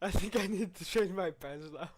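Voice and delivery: shaken laugh through the words; talking through real laughter. Real, not acted. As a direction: laugh while admitting you were scared. Laughing after the scare.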